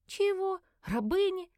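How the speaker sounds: high incredulous questions; taken aback, high-voiced; startled disbelief. A narrator's reading. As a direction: blurt it back, pitched right up. Heroine reacts to being called slave.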